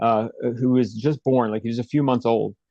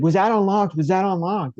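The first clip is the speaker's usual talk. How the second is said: frantic yelling; 7 semitones higher than usual. Retold or imitated. Woman to police at the door, frantic yell. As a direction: demand an answer in a panic. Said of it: "she starts yelling".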